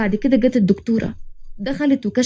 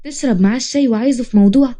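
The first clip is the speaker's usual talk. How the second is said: plain reading voice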